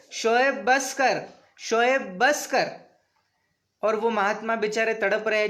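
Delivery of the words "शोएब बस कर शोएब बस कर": slow, drawn-out retelling of the plea; each word stretched, a commentator dwelling on the plea for his viewers; slow pleading quote. Retold or imitated. Commentator slowly retelling the victim's cry to viewers, drawn-out and grave. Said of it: "शब्द".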